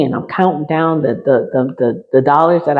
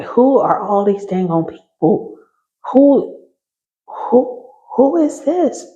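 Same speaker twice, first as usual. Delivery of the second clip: much higher, squeaking in disbelief; astonished; 8 semitones higher than usual. Retold or imitated. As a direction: voice flies up in amazement. Retold awe seeing spirits.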